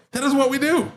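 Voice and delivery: high-pitched, emphatic defence; emphatic insistence. Real, not acted. Shop owner defends his sales routine, high-pitched insistence.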